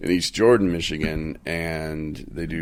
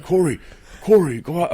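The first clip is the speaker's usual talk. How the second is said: higher, louder urgent call; coworkers calling out; 11 semitones higher, 5 dB louder than usual. Retold or imitated. Coworkers urging Corey to go out, higher and louder. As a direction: call him to come outside. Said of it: "they're like".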